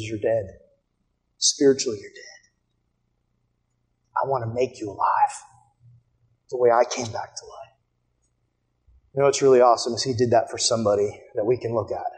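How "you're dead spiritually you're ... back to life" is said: soft, low voice of God speaking; solemn, hushed appeal. Acted. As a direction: bring it right down, quiet and grave. Solemn appeal voicing God to listeners.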